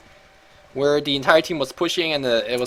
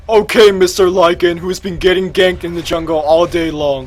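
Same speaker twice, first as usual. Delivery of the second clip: mock address; 3 semitones higher, 8 dB louder than usual. Caster mockingly addresses the hero.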